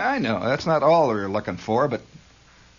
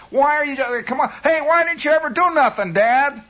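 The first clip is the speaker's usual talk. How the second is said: put-on high, insistent kid's holler; kid challenging Dad; 13 semitones higher, 3 dB louder than usual.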